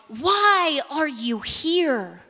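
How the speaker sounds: deliberate, weighty pulpit question; very slow question. Shown as a demonstration. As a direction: stretch out every word, stress on why, then fall. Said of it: "We're asking here on this mountain right now".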